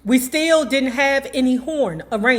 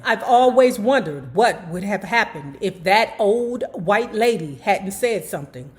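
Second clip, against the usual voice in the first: ordinary delivery, nothing marked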